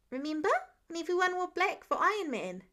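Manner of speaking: high, sure kindergartner voice; a kid stating the obvious; matter-of-fact little boy. Acted. Child reminding Peter.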